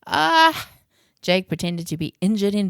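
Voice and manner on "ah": mock battle cry of pain. Jake pretends a battle injury.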